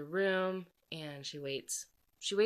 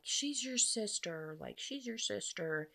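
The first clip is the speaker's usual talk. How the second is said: higher-pitched imitation of a pleading mom; lightly plead, raised pitch, drawn out; mock pleading; 5 semitones higher, 3 dB quieter than usual. Mother pleading for sister, retold.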